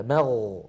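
"مغ" with the gh sustained, low; flowing ghayn. Shown as a demonstration. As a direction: hold the ghayn at the end of "مغ". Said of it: "لاحظوا هكذا".

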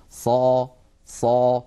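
emphatic صا, tongue lifted toward the roof of the mouth; heavy emphatic ṣād. Shown as a demonstration. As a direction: stretch out صا with the sound rising to the palate. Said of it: "صوت الصاد يتصعد إلى أعلى الفم".

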